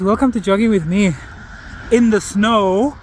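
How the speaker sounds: bright, loud, upbeat hello to viewers; excited greeting. Real, not acted. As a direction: greet your audience with energy. Upbeat vlog intro greeting.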